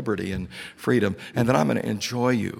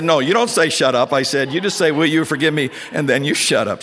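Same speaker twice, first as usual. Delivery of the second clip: laughing loud correction, pitched up; amused loud correction; 5 semitones higher, 6 dB louder than usual. Retold or imitated. Speaker correcting the leader on stage, laughing and loud. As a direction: set him straight through laughter.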